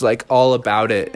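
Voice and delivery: ordinary delivery, nothing marked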